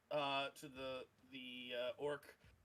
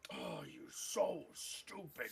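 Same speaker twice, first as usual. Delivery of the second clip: orc scolding; 3 semitones higher, slower than usual. Acted. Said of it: "And then the orc".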